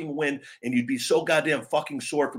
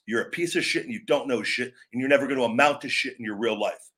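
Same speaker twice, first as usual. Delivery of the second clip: ordinary talk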